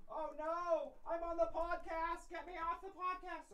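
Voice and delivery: high, soft little cat voice; cat pleading in a high, soft voice; cat's voice. Pretend. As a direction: voice the cat begging to leave, high and hushed. Cat speaking on podcast.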